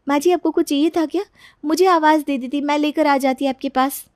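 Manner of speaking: high, eager-to-please voice; anxious daughter-in-law, placating. Acted. Daughter-in-law offers to fetch things for mother-in-law, high and placating. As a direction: cover up with nervous politeness.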